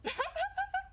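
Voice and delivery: high shrieking laugh. Real, not acted. Laughing at own story, a high shriek.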